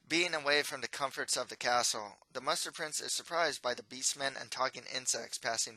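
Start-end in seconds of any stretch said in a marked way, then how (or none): none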